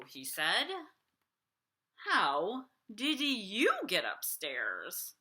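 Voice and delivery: put-on lively read-aloud for kids; storybook reading. A narrator's reading. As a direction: read it like bedtime story time. Reading picture book aloud.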